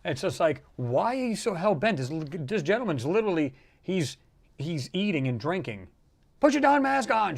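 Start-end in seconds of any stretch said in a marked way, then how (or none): 6.4-7.4 much higher, shrill-pitched demand; mock angry yell. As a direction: imitate a furious woman on a plane. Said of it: "She's literally ripping him a new one".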